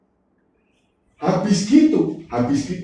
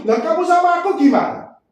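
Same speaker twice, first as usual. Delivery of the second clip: higher, louder turned-round challenge; Jesus asking him, higher, pressing; God questioning him; 11 semitones higher, 4 dB louder than usual. Retold or imitated. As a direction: fire the questions back at him. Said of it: "Waktu saya dibalik begitu".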